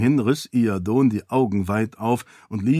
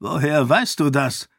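higher, suspicious question; startled king; 4 semitones higher than usual. Acted. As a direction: caught off guard. (überrascht, misstrauisch)

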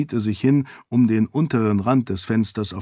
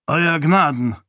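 raised, firm salute to a lord; respectful greeting; 5 semitones higher, 4 dB louder than usual. Acted. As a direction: greet your lord formally, voice lifted. Priest greets his lord respectfully.

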